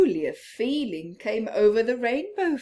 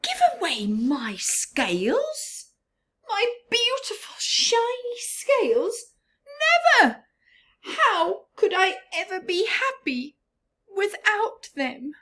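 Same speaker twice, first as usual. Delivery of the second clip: much higher indignant fish voice; proud Rainbow Fish; 11 semitones higher, 4 dB louder than usual. Acted. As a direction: clutch your scales, high and outraged.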